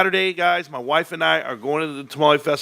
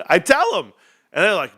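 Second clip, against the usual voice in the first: ordinary talk, nothing marked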